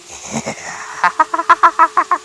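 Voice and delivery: very high, shrill cackle; eerie high-pitched laughter; frightening ghost cackle. A narrator's reading. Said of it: "sambil tertawa".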